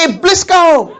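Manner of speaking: the wife firing it straight back, half laughing; mock angry retort. Istri membalas makian suaminya. (balasan marah pura-pura, tinggi, sambil tertawa)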